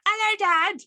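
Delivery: a kid's mock-innocent greeting in a funny accent; funny little accent. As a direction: pop up high as the son who ruins everything. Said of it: "the funny little accent".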